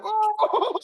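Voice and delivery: a sudden, very high yelp of surprise; as if the ball just caught you off guard; startled oh. Retold or imitated. Umpire struck by the ball, letting out a high startled oh.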